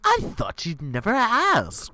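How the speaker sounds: slow, high, gloating villain. Acted. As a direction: relish the line like a supervillain. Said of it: "Prodigal says".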